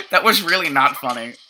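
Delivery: usual speaking voice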